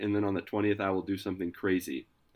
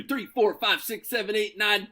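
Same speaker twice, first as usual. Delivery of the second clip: ordinary delivery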